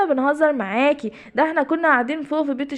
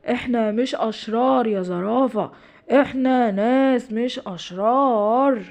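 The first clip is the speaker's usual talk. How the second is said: slow spooky voice; much slower than usual. Acted. Ardon hiding above speaks to the giraffe slowly and eerily. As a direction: stretch each word to sound eerie.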